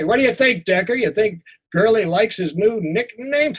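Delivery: the bully mocking the boy to Decker; mocking question. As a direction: taunt him while asking your buddy. Bully mocks to his friend.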